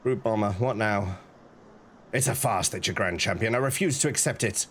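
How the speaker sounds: gruff villain. Acted. Voicing the villain challenger.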